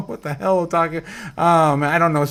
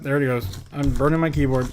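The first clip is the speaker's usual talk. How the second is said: usual speaking voice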